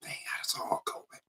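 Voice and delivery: hushed nudge to a neighbor; whisper. Retold or imitated. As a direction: whisper it behind the hymnbook. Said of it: "And they be like".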